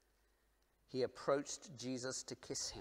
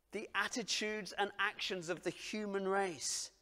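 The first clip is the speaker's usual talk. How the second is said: loud, higher impassioned preaching; full-force preaching; 7 semitones higher, 7 dB louder than usual. Acted. Preacher to congregation on God's love, loud and impassioned. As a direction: preach it out to the room.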